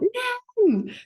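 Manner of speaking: high called-out name; a ringing call to a grandmother. Retold or imitated. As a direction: call her name across the street. Calling out to nan in dream.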